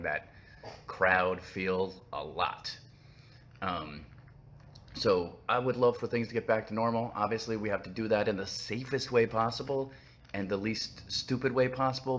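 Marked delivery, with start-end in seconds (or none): none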